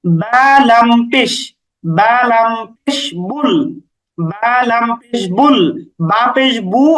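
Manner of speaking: slow, careful letter-by-letter recitation; slow spelling out. Shown as a demonstration. Teacher shows students how to read বুল, slowly. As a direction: draw each letter out for the class. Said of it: "তাহলে আমরা কিভাবে পড়বো?".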